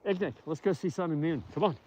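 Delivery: high, soft coaxing pet-talk; calling to dog. Real, not acted. Owner urging dog to see wolf friends, soft high coaxing.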